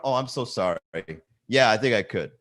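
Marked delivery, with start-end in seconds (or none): none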